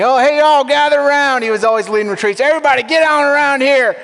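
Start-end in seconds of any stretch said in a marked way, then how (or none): 0.0-1.4 high, loud Southern drawl calling folks in; Hooli's Southern drawl. Retold or imitated. Mentor Hooli gathering everyone at a retreat, loud Southern drawl. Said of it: "we're starting to talk like Hooli". 2.4-4.0 high, loud Southern drawl calling folks in; Hooli's Southern drawl. Retold or imitated. Mentor Hooli gathering everyone at a retreat, loud Southern drawl. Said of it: "That was his great voice".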